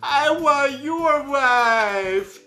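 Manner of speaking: very slow, high, loud mock wail; wailing kid. Imitating the crying son wanting his mom, slow high mock wail. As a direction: drag it out like a wailing toddler. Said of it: "the kid is wailing".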